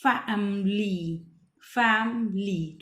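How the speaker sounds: फैमिली slowly split into three drawn-out parts; फैमिली stretched syllable by syllable for a young reader. Shown as a demonstration. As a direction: sound out फैमिली in pieces. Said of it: "हमने इसको break करा है ऐसे".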